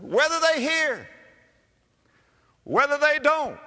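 loud, high cry with falling ends; exultant preaching. Real, not acted. Preacher proclaiming the verse to the congregation, exultant. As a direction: proclaim it in two big separate bursts.

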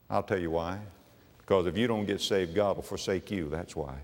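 ordinary talk, nothing marked

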